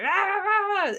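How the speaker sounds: high-pitched mock rant, "rah rah rah"; mock yelling. Mother catching herself mid-argument, mock yelling. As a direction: imitate your own yelling as wordless high noise. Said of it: "catch myself in the middle of it".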